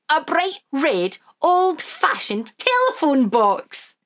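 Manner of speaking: ordinary delivery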